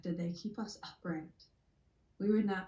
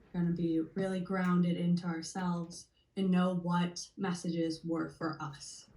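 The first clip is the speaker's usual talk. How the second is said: usual speaking voice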